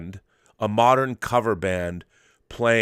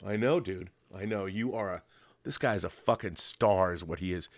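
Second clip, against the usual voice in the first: ordinary talk, nothing marked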